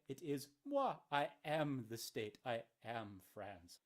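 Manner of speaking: ordinary talk, nothing marked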